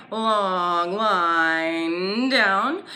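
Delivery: very slow, stretched "Long line down"; slow drawn-out instruction. Shown as a demonstration. Teacher guiding fingers down a long line, slow and stretched. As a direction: draw the words out like the stroke. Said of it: "Fingers up".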